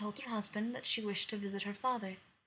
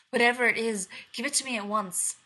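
loud urgent demand; 8 dB louder than usual. Acted. Queen demands the potion.

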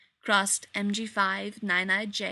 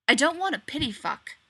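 louder, biting retort; snapped; 5 semitones higher, 5 dB louder than usual. A narrator's reading. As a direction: spit it out.

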